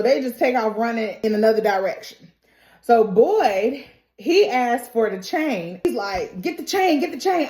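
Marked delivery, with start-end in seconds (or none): 6.4-7.5 higher-pitched urgent order; acted urgency. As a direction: call for it urgently. Acting out urgent order for chain.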